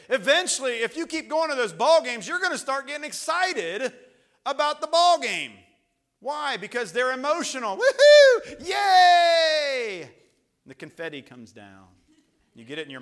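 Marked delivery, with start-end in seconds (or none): 0.1-7.8 loud, high-pitched pulpit delivery; urgent preacher at full volume; full-force preaching. Real, not acted. 7.8-10.0 very high, loud crowd whoop; long, shrieked celebration; whooping cheer. Acted. Acts out cheering baseball fan.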